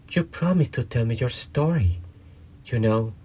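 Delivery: put-on high, light girlish voice; young girl voice. A narrator's reading. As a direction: read Alice as a young girl. Alice voiced in the story.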